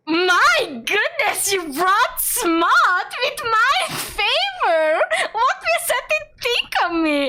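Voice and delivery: high, drawn-out squeal through laughter; helpless laughter. Real, not acted. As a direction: read it while cracking up. Reads the line through helpless laughter.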